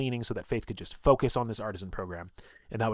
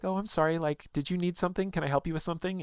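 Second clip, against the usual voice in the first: bright, high, sugary helpful voice, pitched well up; all cheery; 9 semitones higher than usual. Retold or imitated. Mila cheerfully offering help to the mean girls, high and sweet. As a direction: gush with overeager sweetness to cover the insult. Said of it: "like, all cheery".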